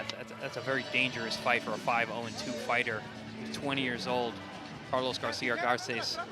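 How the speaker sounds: usual speaking voice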